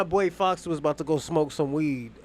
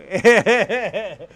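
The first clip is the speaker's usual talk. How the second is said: laugh; 3 semitones higher, 9 dB louder than usual. Real, not acted. Laughing about the scam story.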